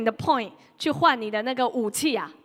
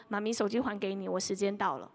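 nice and polite; 4 semitones lower, 6 dB quieter than usual. Retold or imitated. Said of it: "他就会好好的说".

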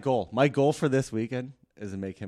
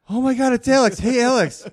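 excited; 12 semitones higher, 10 dB louder than usual. Retold or imitated. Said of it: "And you're like".

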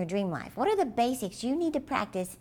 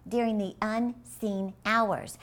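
slower, weighted words; slow emphasis; slower than usual. Shown as a demonstration. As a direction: lean on the phrase slowly for effect. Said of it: "Did you hear that part?".

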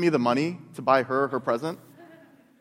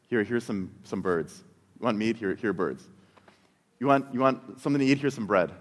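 lower, offhand giving voice; the Spirit answering grumbling Israelites, lower and matter-of-fact; 6 semitones lower than usual. Retold or imitated. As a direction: hand it over plainly, pitched lower. Spirit voiced offering food.

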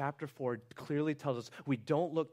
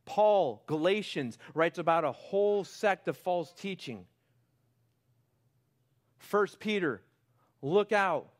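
loud, rising high preacher's build; full-force sermon climax; impassioned preaching; 6 semitones higher, 7 dB louder than usual. Real, not acted. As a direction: pour it out to the church.

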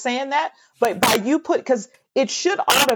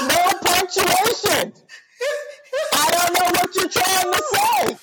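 laughing through exasperation; 7 semitones higher than usual. Real, not acted. Laughing at run-on bios.

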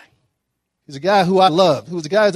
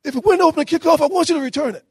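much higher, squeaky excited coach voice; 11 semitones higher than usual. Retold or imitated. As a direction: mimic the coach all worked up and pitched way up. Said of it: "Coach, uh, Faust gets all excited".

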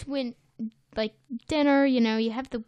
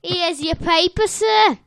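mock Bronx newsboy; 5 semitones higher, 7 dB louder than usual. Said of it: "newspaper boy".

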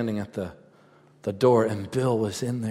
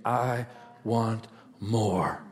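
ordinary delivery

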